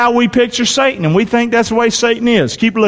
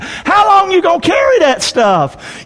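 challenging shout; 9 semitones higher than usual. Real, not acted. Preacher challenging hurt congregation.

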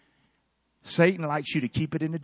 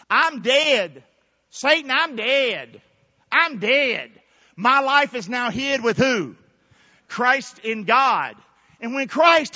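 higher, louder sermon cadence; preaching at full force; 6 semitones higher, 6 dB louder than usual. Acted. Preacher proclaiming to the congregation, full force. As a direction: proclaim it, drawing out the words.

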